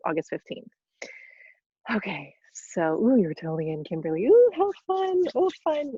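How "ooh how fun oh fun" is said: delighted squeal. Real, not acted. Delighted reaction to registration.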